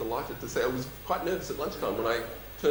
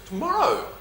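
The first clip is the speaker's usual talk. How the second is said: excited; 6 dB louder than usual. Real, not acted. Announcing the replacement date.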